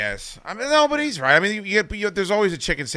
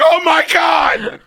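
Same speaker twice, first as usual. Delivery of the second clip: high, loud, slow Oh my God shout; retold shout; 7 dB louder, much slower than usual. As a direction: belt it out in disbelief, drawn out. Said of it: "I'm like".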